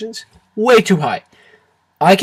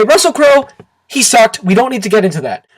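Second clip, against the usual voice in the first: normal talking voice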